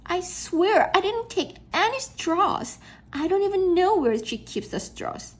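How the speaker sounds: put-on earnest high kid plea; pleading kid. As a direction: swear you're innocent.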